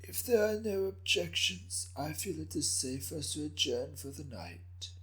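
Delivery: put-on an old man chuckling softly through his words; raspy old man, amused. The ancient daimyo dismisses the room.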